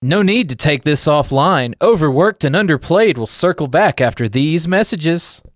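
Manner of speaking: loud, lively mock announcer patter; punchy show-host sign-off. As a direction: toss to the break like a host. Show host's break announcement.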